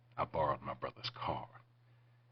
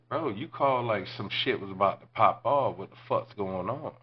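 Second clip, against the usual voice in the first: high, heated retold complaint; acted exasperated outburst; 6 semitones higher, 6 dB louder than usual. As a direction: argue back, pitched up and pushing. Acted argument in retold story.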